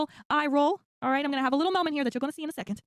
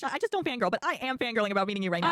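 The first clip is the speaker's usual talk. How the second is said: ordinary talk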